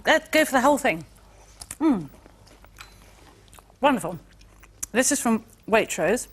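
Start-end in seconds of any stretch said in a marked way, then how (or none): 1.8-6.3 talking round a spoon in mouth; words muffled by a spoon in mouth. Real, not acted. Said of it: "when you've got a spoon in your mouth".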